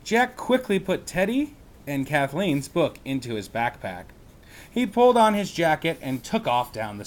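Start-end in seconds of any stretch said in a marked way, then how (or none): none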